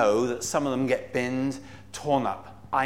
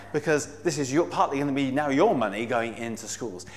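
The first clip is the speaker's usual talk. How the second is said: normal talking voice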